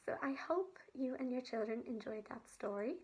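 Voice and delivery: usual speaking voice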